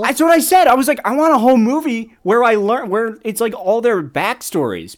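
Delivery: loud, high, eager outpouring; excited agreement. Real, not acted. Fan wanting a whole backstory movie, loudly excited. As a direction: gush loudly over a shared opinion.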